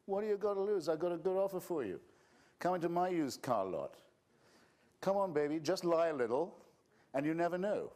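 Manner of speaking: high, loud, oily used-car salesman pitch; religious huckster. Retold or imitated. Mocking Pascal's wager as a used car lot pitch, huckster voice. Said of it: "religious hucksterism of the cheapest".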